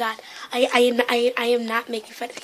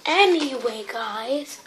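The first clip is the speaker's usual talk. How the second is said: ordinary talk, nothing marked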